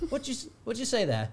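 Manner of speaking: high incredulous asking; baffled question. Retold or imitated. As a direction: squint at the crowd. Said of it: "I was like".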